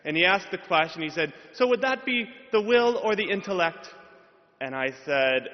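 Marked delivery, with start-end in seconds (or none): 1.5-3.9 Irish professor. Retold or imitated. Professor voiced in retold exam.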